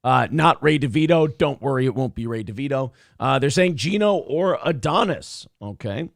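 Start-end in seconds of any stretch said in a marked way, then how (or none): none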